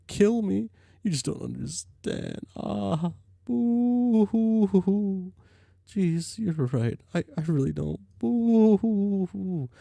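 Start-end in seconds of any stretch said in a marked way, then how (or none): 3.5-5.3 mock ghost sobbing. Jade wails that nobody understands. 8.2-9.7 mock ghost sobbing. Jade wails once more.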